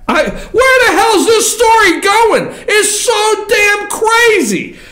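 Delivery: high-pitched disbelief, stretching the last words; exasperated outburst. Real, not acted. Host baffled by where this story is going, exasperated. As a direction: cry out at the absurdity.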